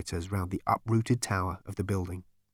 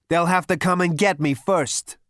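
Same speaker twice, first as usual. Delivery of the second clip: loud, high, cocky retort; defiant; 9 semitones higher, 7 dB louder than usual. Acted. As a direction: challenge them at full voice. Defiant retort in acted quarrel.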